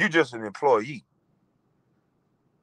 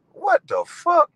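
incredulous; 4 dB louder, slower than usual. Real, not acted. Incredulous at a rival's online talk.

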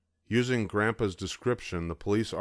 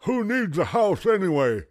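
moaning toothless grandpa; 11 semitones higher, 7 dB louder than usual. A narrator's reading. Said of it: "he moaned".

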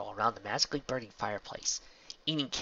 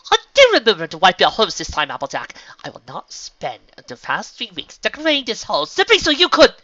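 high, forceful fussy complaint; loud scolding; 10 semitones higher, 15 dB louder than usual. Acted. Rarity scolds Applejack at the door, loud complaint. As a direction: build up into a shrieked reproach.

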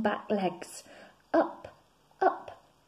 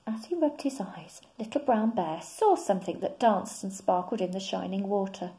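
ordinary delivery

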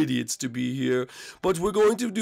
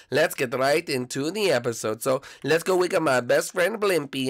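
normal talking voice

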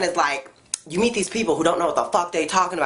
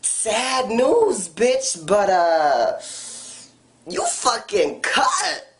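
mock blunt dismissal; 4 semitones higher, much slower than usual. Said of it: "you gotta just be like".

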